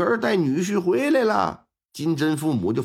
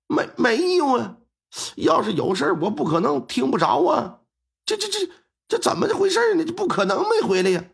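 flustered denial; 8 semitones higher than usual. Acted. Driver denies, stammering in panic.

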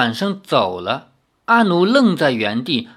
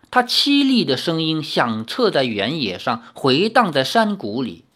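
ordinary delivery

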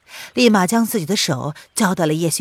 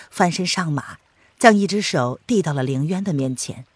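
ordinary delivery

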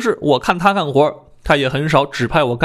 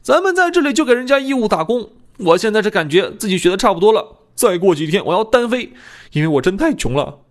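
a touch higher, mildly worked-up complaint; lightly voiced aggrieved apprentice; 7 semitones higher than usual. A narrator's reading. Apprentice complains to a colleague about working unpaid, lightly aggrieved. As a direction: voice a colleague airing a grievance.

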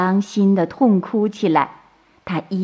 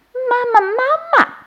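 put-on very high, drawn-out child's call; loud calling; 3 dB louder than usual. A narrator's reading. Baby turtles mistake crab for mother, calling out eagerly. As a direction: cry out for mum in a tiny hatchling voice. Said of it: "大声地叫".

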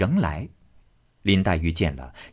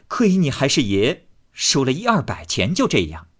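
louder, higher mocking scold; scornful lady; 5 semitones higher, 4 dB louder than usual. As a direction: shame him for fussing over pennies. Xifeng mocks him.

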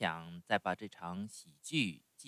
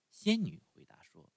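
ordinary delivery

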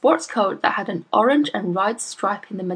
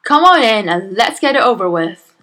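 grudging, impatient; 2 semitones higher, 8 dB louder than usual. A narrator's reading. Father grudgingly admits teacher.

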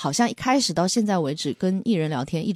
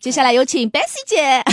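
louder, higher formal announcer voice; formal radio intro; 6 semitones higher, 5 dB louder than usual. Shown as a demonstration. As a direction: announce the guest like a DJ. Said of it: "很正式的那样的一个".

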